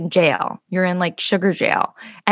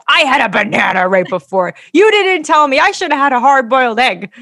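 loud, higher exasperated complaint; indignant; 6 semitones higher, 6 dB louder than usual. Retold or imitated. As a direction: protest to the doctor with rising outrage. Arguing with the doctor.

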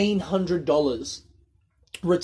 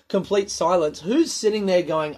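ordinary talk, nothing marked

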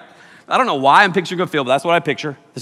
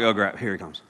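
dropped low and soft, muttered dread; half under the breath, dreading him; a quiet, low mock groan among friends; hushed groan; 8 semitones lower, 6 dB quieter than usual. Teens dreading approaching person.

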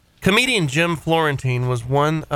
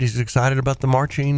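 ordinary talk, nothing marked

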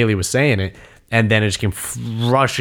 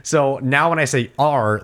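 ordinary delivery

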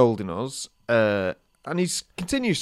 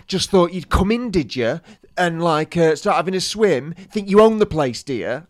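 raised, louder mock telling-off; retold scolding; 5 semitones higher, 6 dB louder than usual. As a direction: imitate a swimming instructor having a sarcastic go. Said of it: "wouldn't stop shouting in front of all".

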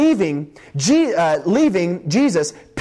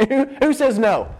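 normal talking voice

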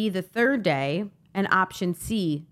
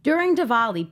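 ordinary delivery

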